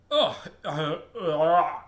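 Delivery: loud "ugh" of revulsion; disgusted groan. Acted. Helen testing the strongest bug spray, loud disgusted groan. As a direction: choke out loud yucky groans.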